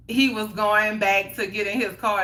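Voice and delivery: ordinary talk, nothing marked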